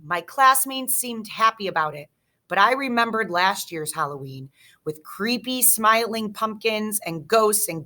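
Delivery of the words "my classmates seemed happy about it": ordinary delivery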